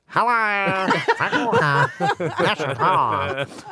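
high child imitating ad voice; squeaky kid impression; child doing Frank Walker. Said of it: "she'll pop out and go".

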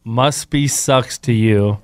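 slow, low deadpan delivery; slow deadpan quote. Retold or imitated. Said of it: "his only response back was".

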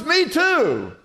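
high, loud, eager acted reply; thrilled to find common ground; hammed-up joke-teller excitement; excited agreement. Passerby delighted at match.

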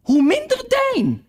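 high, slow put-on duchess; lofty duchess ends her moral. A narrator's reading. Duchess lecturing Alice, in a high slow voice.

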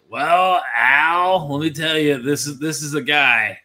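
loud, hyped broadcaster voice; Cris Collinsworth impression. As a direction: sell it like a TV color commentator. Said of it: "Collinsworth, like, really sell it".

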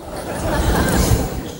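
short breathy laugh at his own joke; soft laugh. Real, not acted. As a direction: chuckle quietly.

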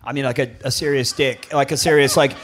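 usual speaking voice

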